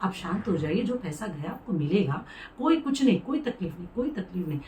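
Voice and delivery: calm her down softly like a smooth conman; hushed, reassuring con man; soothing scammer. Retold or imitated. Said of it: "वो same मेरे साथ भी ऐसे बोल रहा था".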